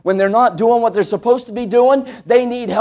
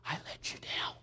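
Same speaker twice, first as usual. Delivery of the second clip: whisper; 26 dB quieter than usual. Retold or imitated. Reading a dying girl's letter.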